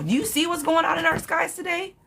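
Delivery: high incredulous question. Real, not acted. Reader warning a viewer not to fly today, incredulous. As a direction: jump up high in disbelief.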